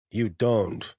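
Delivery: a curt, sharp put-down; sharp, disdainful. A narrator's reading. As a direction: cut them off with mild disdain. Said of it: "the steel sphere said sharply".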